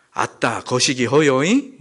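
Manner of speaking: slow, roundabout Jeolla dialect. Acted. Preacher imitating a sketch love confession, Jeolla dialect. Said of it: "전라도는 어떻게 표현할까요?".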